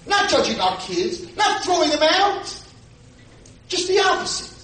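high, loud pleading preaching; preaching at full force. Acted.

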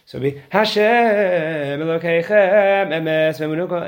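"Emes V'Emunah" sung joined without a pause; "Emes V'Emunah" chanted as a single phrase; one phrase. Shown as a demonstration. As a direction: run "Emes" straight into "V'Emunah". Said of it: "you want Emes V'Emunah to be one phrase".

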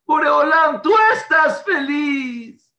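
slow, high, loud cry to heaven; shouting to God. Retold or imitated. Bereaved father shouting his praise to God. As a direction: call out to God with all your strength. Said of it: "Y empezó a gritar".

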